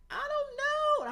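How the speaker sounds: very high, loud and slow, a hammed-up sob; mock sobbing reply. Answering her partner while crying for no reason, mock sob. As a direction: stretch it out like a crybaby. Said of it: "I'm crying at the drop of a dime".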